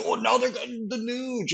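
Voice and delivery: high-pitched imitation of alarmed fans; mock panic. Fans reacting to a draft pick, mock panic. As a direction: wail it like a fan dreading the news. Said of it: "everyone would shit their pants".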